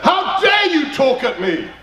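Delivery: high, indignant mock shriek of the angry woman; mimicked outrage. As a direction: act out her outraged cry, hammed up. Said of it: "And she said".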